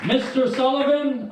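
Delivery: ordinary talk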